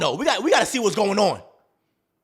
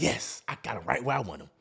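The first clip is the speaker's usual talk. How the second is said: soft, low, gloating woman's voice; mock girlfriend savoring his anger; scheming woman; 11 semitones lower, 10 dB quieter than usual. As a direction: quietly gloat like you've trapped him. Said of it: "she's like".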